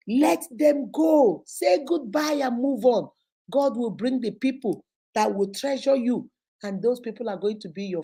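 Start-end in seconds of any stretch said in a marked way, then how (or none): 0.1-3.1 slow, drawn-out exhortation; emphatic urging. Real, not acted. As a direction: stretch each word to drive the advice home. Urging listeners, repeated for emphasis.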